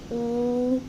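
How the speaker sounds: ऊ held long and level; drawn-out ऊ. Shown as a demonstration. Teacher answers how the matra sounds, drawn-out ऊ. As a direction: stretch ऊ out on one steady note. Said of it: "sound कैसे हो जाएगी?".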